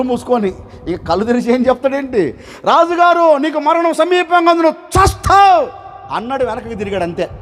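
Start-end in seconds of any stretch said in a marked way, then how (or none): 2.6-5.7 stern proclamation. Acted. Servant declares death to the king, shouting. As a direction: announce doom to the king, booming.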